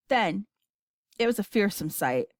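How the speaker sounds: ordinary delivery, nothing marked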